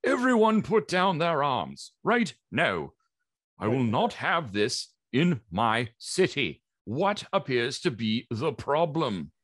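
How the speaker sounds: high, stern sergeant at arms; commanding sergeant. Acted. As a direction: take charge like a sergeant giving orders. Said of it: "And so the sergeant says".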